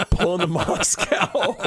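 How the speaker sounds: laughing through the words, slow; can't get the line out for laughing; laughing restart. Real, not acted. Comic retelling his train story, cracking up mid-line.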